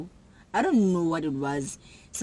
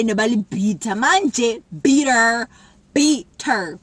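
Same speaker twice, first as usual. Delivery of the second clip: egging on; 3 semitones higher, 6 dB louder, slower than usual. Retold or imitated. Egging on a fight.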